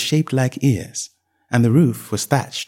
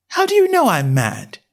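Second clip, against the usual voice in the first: put-on young girl voice; 8 semitones higher than usual. A narrator's reading. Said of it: "said Alice".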